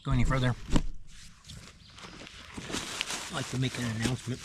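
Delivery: talking with a mouth full. Real, not acted. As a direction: speak through a mouthful of food. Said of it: "Excuse me for talking with my mouth full".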